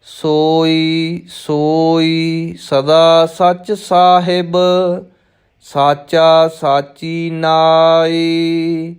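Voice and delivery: measured chant-like recital with drawn-out line endings; scripture recitation. Shown as a demonstration. Teacher reciting Gurbani for new students, measured chanted recitation. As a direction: recite slowly on a level, sustained tone.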